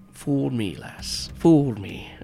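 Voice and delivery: hammed-up old sea dog; amused pirate captain. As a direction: play a pirate captain tickled at being fooled. Pirate captain fooled by a squirrel.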